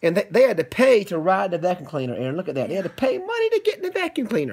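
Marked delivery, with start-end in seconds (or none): 2.7-4.5 high, quick, amazed voice. Acted. Dad to his son, high, quick amazement. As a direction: marvel at it for the child. Dad telling the story to Aaron.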